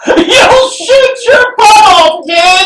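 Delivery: giggling movie line; laughing quote. Pretend. Quoting Christmas Story, laughing.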